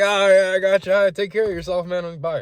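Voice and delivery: high, loud hurried goodbye; awkward brush-off. Retold or imitated. Narrator excusing himself from the drinker, high and loud. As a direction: get out of the chat politely.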